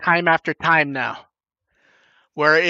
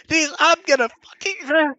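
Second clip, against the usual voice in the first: higher-pitched mock ranting; imitated cursing rant; 9 semitones higher than usual. Host retelling Dev's outburst to co-hosts, mock cursing rant. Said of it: "started cursing and ranting".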